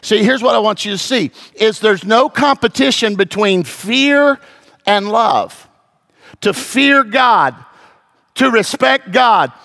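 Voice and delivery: high-pitched fervent sermon delivery, driving each point home; preaching at full force. Acted. Pastor urging his congregation to fear and love God, fervent preaching.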